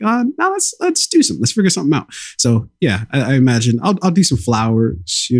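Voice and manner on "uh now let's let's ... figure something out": eager. Retold or imitated. Mock eager partner voice.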